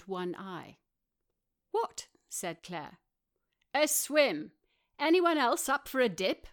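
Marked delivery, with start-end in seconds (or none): 1.7-2.1 much higher, quick What?; sharp question. A narrator's reading. Claire responds quickly. 3.7-6.5 higher, louder, eager invitation; keen Rory. A narrator's reading. Rory proposes a swim.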